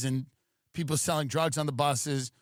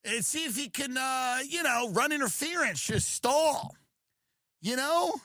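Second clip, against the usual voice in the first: high, fast mocking impression of the president; lightly voiced boss rambling; 8 semitones higher than usual. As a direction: put on a higher voice to mock him. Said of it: "He's like".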